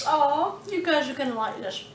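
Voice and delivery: normal talking voice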